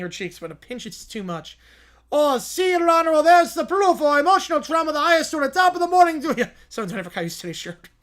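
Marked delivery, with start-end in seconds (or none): 2.1-6.5 high, loud pompous courtroom lawyer; hammy lawyer voice. Acted. To the judge, a blustering lawyer pleading his case. Acted lawyer in mock trial.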